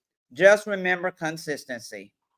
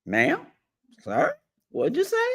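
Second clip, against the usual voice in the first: put-on child leaning in; 7 semitones higher than usual. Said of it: "and say".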